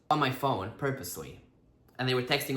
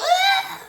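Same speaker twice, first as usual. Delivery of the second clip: very high, loud mock squeal; 7 dB louder than usual. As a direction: squeal in imitation, breaking into laughter. Acting out her kiss reaction.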